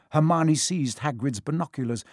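usual speaking voice

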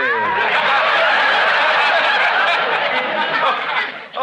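whooping high laugh; big delighted cackle; loud high laugh. Acted. Doctor drops his front.

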